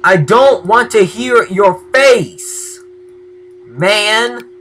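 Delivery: slow, high, loud yell. Real, not acted. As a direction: drag the shout out in irritation. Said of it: "I'm yelling at a mob".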